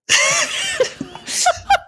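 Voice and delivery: big burst of high laughter; loud high laugh. Real, not acted. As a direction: crack up loudly. Laughing at the imitation.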